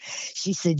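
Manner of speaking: ordinary talk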